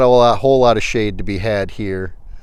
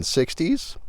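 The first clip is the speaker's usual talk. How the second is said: voice cracking up an octave; 6 semitones higher, 6 dB quieter than usual. Real, not acted. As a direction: voice cracks upward mid-phrase. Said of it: "Uh, my voice just cracked".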